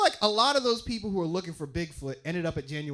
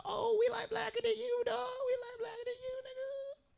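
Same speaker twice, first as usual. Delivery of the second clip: much higher, squeaky wannabe voice; 9 dB quieter than usual. Retold or imitated. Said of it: "That's how they sounded".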